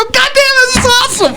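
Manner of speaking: high, thrilled exclamation; gleeful. Retold or imitated. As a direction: crow with delight, way up high. Delighted at free drink.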